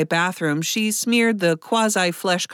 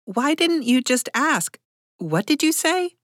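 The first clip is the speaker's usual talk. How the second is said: higher-pitched, mildly taken aback; startled question; 7 semitones higher than usual. A narrator's reading. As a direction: ask it high, thrown by the odd request. Startled woman questions the beggar.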